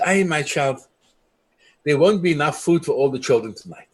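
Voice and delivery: ordinary talk, nothing marked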